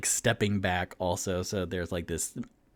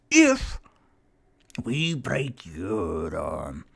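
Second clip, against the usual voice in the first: put-on Scottish accent. Thornin demanding repayment from Toot Toot, Scottish accent. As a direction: lay out the threat in Scottish.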